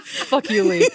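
laughing through the words; giggling while cursing, playful. Real, not acted. Laughing mock insult.

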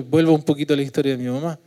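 ordinary talk

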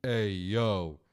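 low, loud catchphrase impression; Scott Hall. Doing a wrestler's patented greeting, low and loud impression. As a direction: drop the pitch and project the greeting. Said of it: "After he does his patented".

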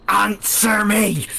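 high, slow barked demand; commanding shout. Acted. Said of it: "and she's like".

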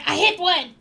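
high triumphant goblin yelp; goblin cheer. Acted. Goblin gloating after the shot, high cheer. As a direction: crow over the hit. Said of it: "you hear him say".

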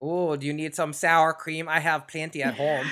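joining in a mock Minnesota accent. Host offering sour cream in the bit, mock Minnesota accent. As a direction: play a neighborly Minnesotan, accent put on. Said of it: "my Minnesota accent".

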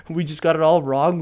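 ordinary delivery

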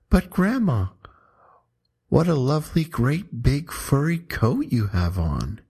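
put-on high, sweet girl's voice; a hint of a curious little girl; lightly voiced little girl. A narrator's reading. Girl's voice in the poem.

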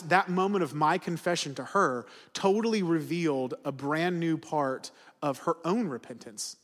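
ordinary talk, nothing marked

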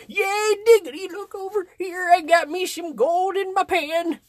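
put-on old prospector. Shown as a demonstration. Showing an old prospector voice, high and put-on. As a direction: crow like an old gold panner. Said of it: "it's an old prospector voice".

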